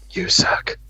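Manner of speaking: sneaky whispered insult; whispered jab. Pretend. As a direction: slip it in under your breath, whispering.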